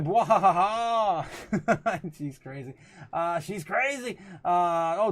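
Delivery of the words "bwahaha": mock evil laugh. Reads chat's villain laugh aloud.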